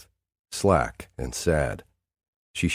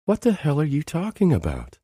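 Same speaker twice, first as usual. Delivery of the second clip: perplexed; 11 semitones higher than usual. A narrator's reading. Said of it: "perplexed eyes".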